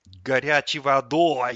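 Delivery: loud, slow grumbling echo; disgruntled. A narrator's reading. Tap echoes the fridge's order, loud disgruntled grumble. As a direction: grumble it back loudly, drawn out. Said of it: "недовольно буркнул тот".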